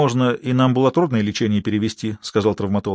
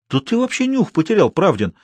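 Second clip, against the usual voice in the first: high indignant outburst; indignant, rising pitch; 7 semitones higher than usual. A narrator's reading. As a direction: boss indignant at the doctor's cheek. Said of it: "возмутился Тихий".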